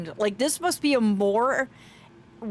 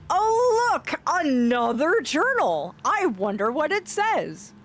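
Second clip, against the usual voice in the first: hammed-up excitement; 5 semitones higher, slower than usual. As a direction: gush theatrically with a drawn-out "Oh, look". Theatrical reaction to finding another journal.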